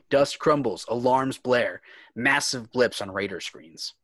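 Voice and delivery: ordinary delivery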